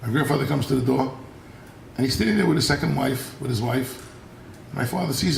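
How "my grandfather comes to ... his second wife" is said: ordinary delivery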